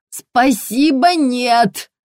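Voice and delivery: tight, drawn-out brush-off; strained politeness. A narrator's reading. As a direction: force out courtesy to be rid of her. Strained colonel refuses saleswoman.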